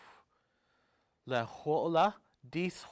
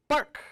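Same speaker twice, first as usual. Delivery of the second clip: "Bark!" yelped higher and louder like a seal; a sharp high yelp of a seal coming alive; 11 semitones higher, 6 dB louder than usual. Retold or imitated. The carved seal finally barks right, high loud yelp. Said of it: "It barked just like a seal".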